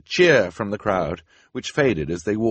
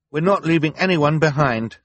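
raised, forceful refusal; firm; 8 semitones higher, 7 dB louder than usual. A narrator's reading. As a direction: put your foot down, louder and higher. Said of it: "Klaus said firmly".

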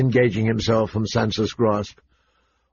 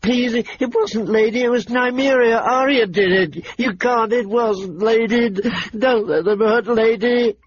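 put-on higher, slow, near-crying girl begging; tearful pleading; 11 semitones higher than usual. A narrator's reading. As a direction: beg the father through tears. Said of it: "She started to cry".

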